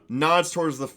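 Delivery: normal talking voice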